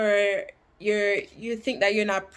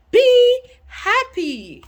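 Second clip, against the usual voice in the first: excited urging; 13 semitones higher, 9 dB louder, much slower than usual. Real, not acted. Urging viewers to be happy.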